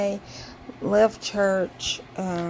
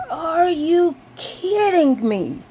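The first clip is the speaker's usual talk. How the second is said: high, slow, loud wail of disbelief; half-crying outraged disbelief; disbelieving wail; 10 semitones higher, 7 dB louder, slower than usual. Retold or imitated. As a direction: drag it out like you can't believe it. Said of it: "And I was like".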